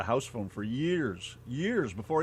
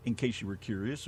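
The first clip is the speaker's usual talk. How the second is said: ordinary talk, nothing marked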